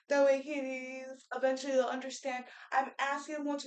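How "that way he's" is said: usual speaking voice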